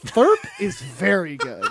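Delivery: laughing. Real, not acted. Laughing at the name.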